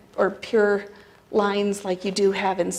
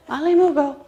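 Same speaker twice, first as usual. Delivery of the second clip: higher, louder call of "Male Mugo"; mock yell across the property; 7 semitones higher, 6 dB louder than usual. Tenant calling greeting across property, loud. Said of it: "he would yell".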